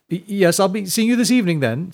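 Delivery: high, hurried, oily goodbye; stammer, then recover with false cheer; flustered town head. A narrator's reading. Monk Bat rebuffed, hastily signing off.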